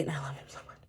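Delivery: soft swooning whisper about him; gushing whisper. Real, not acted. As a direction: hush it like a secret crush. Gushing over favourite actor.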